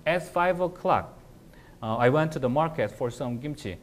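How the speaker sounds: very slow, "At five o'clock" put first; fronted time phrase. Shown as a demonstration. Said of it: "좀 더 과감한 시도를 해볼게요".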